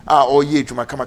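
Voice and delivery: usual speaking voice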